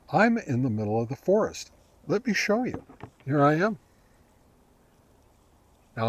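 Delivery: ordinary delivery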